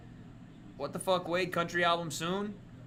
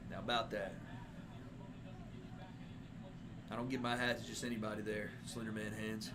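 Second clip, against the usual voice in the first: cowboy voice; 7 semitones lower, 12 dB quieter than usual. Pretend. Cowboy roleplay with hat.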